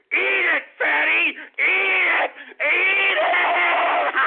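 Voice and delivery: high, loud, half-laughing taunt; gloating yell; crowing shout. Real, not acted. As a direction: yell it with a cracking cackle.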